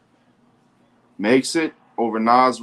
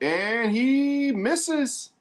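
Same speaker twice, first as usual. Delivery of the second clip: high, slow excited call; live play-by-play rising on the miss; drawn-out call; 12 semitones higher, much slower than usual. Real, not acted. Live call of missed shot.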